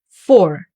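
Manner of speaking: Four said the English way, for with an F. Shown as a demonstration. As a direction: say the English number Four, crisp F. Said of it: "remember how to say this number in English".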